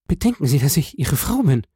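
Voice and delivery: acted anxious plea; pleading wife. A narrator's reading. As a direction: appeal to your husband desperately. Wife begging husband.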